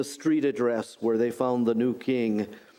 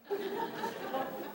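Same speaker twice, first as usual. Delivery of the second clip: real laugh, high and breathy; high burst of laughter; 11 dB quieter than usual. Real, not acted. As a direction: crack up at the joke. Laughs at own Siri story.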